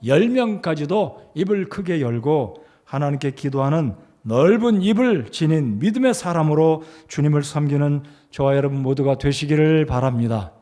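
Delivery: normal talking voice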